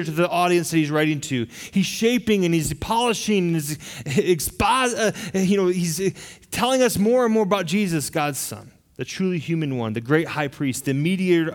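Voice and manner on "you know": ordinary delivery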